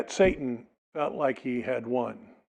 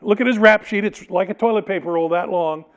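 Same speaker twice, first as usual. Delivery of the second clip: pleading Pilate; 5 semitones higher, 4 dB louder than usual. Retold or imitated. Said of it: "I suspect that Pilate goes".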